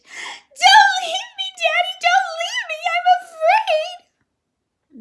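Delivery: very high, loud, slow terrified wail; shrieking. A narrator's reading. Girl clinging to her daddy, shrieking in terror. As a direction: shriek and beg him not to go.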